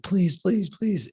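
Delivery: high-pitched imitation of a begging child; pleading kid. Kid begging his mom not to press him, pleading.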